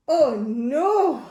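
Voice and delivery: alarmed cry. Acted. Reading to a child, mock alarm.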